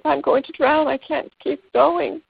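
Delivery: high, slow, giving-up frog voice; despairing frog. Acted. Storyteller voicing the first frog about to drown, high and slow. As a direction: let the frog sink into hopelessness. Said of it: "first frog says".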